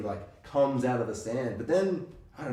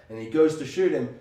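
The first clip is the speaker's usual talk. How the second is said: ordinary delivery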